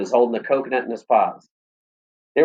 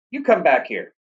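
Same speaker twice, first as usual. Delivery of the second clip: yelled; 5 semitones higher than usual. A narrator's reading. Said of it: "I yelled".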